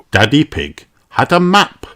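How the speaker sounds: ordinary delivery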